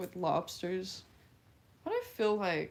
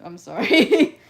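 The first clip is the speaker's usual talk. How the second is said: loud apology; 17 dB louder, slower than usual. Real, not acted. Laughing apology after failed impression.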